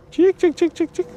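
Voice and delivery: high, loud, repeated chick-chick call; calling chickens. Real, not acted. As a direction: call the hen out in a high sing-song.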